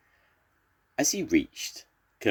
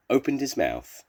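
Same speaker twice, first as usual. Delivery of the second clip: usual speaking voice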